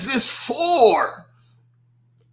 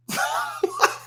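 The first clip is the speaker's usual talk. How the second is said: high giggle; 6 dB quieter than usual. Real, not acted. High laugh.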